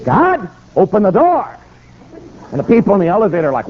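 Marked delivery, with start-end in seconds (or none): 0.1-1.5 praying aloud. Retold or imitated. Preacher still praying aloud in a crowded elevator, imploring. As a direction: call out a prayer as if God must act. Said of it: "He's still praying".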